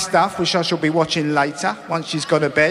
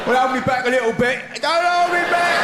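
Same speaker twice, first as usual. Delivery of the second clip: put-on half-hearted drunk shout; 7 semitones higher than usual. Drunk asking to be held.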